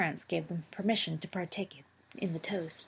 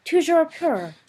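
toujours pur shouted high and loud; loud crowd cry; 10 semitones higher, 9 dB louder, slower than usual. A narrator's reading. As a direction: throw the toast back as a roar. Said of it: "cried every voice".

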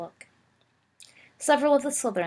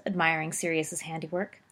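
ordinary delivery, nothing marked

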